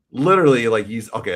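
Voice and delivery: ordinary delivery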